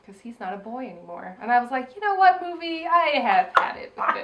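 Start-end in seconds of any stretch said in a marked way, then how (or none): 2.0-4.2 high, fed-up scolding. Retold or imitated. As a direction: tell the movie off, higher than usual. Mock-scolding the movie.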